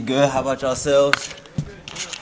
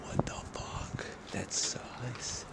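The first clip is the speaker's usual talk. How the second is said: whispering; 3 semitones higher, 18 dB quieter, much slower than usual. Real, not acted. Urban exploring at night.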